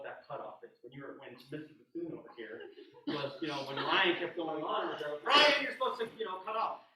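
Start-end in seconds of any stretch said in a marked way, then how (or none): 5.3-6.9 raised, higher voice, mock telling-off; calling out a correction. Clinician imitating someone correcting a student who kept playing, calling out. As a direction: call out loudly to stop a player, lightly acted.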